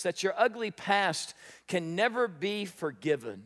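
ordinary talk

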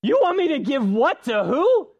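put-on high, loud whiny kid voice; a kid refusing to share; indignant child. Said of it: "you ask a kid to share".

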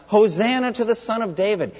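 retold crying out. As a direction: lift the quote high, like a restrained call. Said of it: "the children are crying out".

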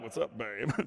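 mock suave come-on; smooth flirty guy. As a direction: lay on the charm like a ripped hunk. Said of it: "be like".

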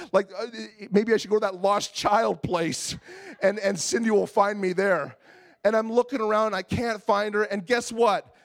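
ordinary talk, nothing marked

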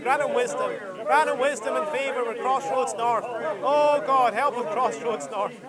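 high, impassioned praying, line after line; fervent prayer. Real, not acted. Pastor praying over Ty and his family, fervent intercession.

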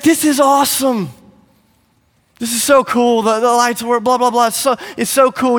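high-pitched, impressed enthusiasm; mock gushing. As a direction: gush like an awed guest. Imagined Jesus gushing at the event.

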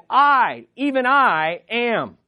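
both I's high and punched, am falling away; emphatic I. Shown as a demonstration. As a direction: hit each I hard with a pause after it. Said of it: "that wording is so emphatic".

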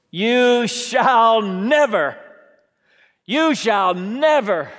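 loud, slow insistent refusal; Peter's protest. Acted. Preacher voicing Peter refusing the Lord, loud and slow. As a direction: draw out the refusal like a stubborn disciple.

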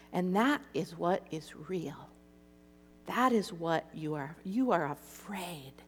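soft, low, slowed-down voice; gentle firm truth. Retold or imitated. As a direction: say it quietly and deliberately, as Jesus would. Voices Jesus gently confronting her.

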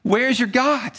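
high-pitched jeering taunt; mock the believer, pitched well up; mocking heckler. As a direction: taunt him like a scornful heckler. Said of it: "they laugh and say".

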